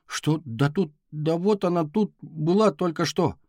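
acted bewilderment, rising as it goes; flustered. A narrator's reading. Boy asked about missing sister. (растерянно, повышая голос)

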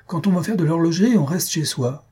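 high, quick indignant scolding; heated retort. A narrator's reading. Host sending clockmaker home, heated retort. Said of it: "répliqua Jujube avec emportement".

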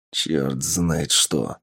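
very slow, low, soft sighed grumble; со вздохом. A narrator's reading. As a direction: sigh the words out slowly. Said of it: "вздохнул Тапок". (тяжело вздыхая)

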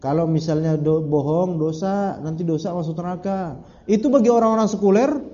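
ordinary talk, nothing marked

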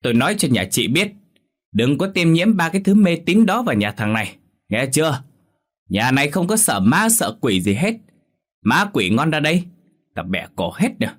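put-on high, loud drunken rant; furious loud yelling; cursing angrily. A narrator's reading. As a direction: hurl abuse at the neighbours. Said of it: "chống nạnh chửi đồng".

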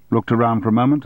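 ordinary delivery

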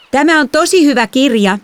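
put-on high, chirpy child's voice; lightly voiced little sister. A narrator's reading. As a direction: play the oblivious younger girl.

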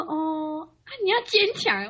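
high-pitched granny encouragement; grandma consoling. Retold or imitated.

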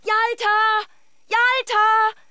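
high, loud frantic call across the street; calling a lost dog, searching desperately. Acted. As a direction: shout the dog's name in panic.

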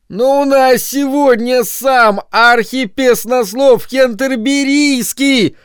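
high, slow cry close to tears; near tears shout. A narrator's reading. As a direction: shout it almost crying. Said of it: "чуть не плача крикнул Бернард".

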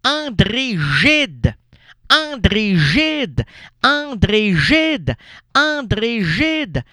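high, very slow, stretched syllables on André Gide; exasperated correction. Acted. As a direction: drum the name in, repeated identically and drawn out. (correction exaspérée, très articulée)

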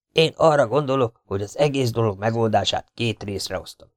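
plain reading voice

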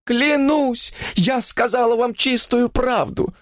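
high-pitched desperate oath; frightened woman insisting; horrified protest. A narrator's reading. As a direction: swear it in horror, pleading. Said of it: "в ужасе проговорила она".

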